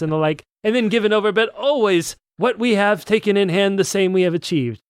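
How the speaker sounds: high, grand kingly reading; pompous royal voice. Acted. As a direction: read it like a pompous king. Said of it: "That's good stuff".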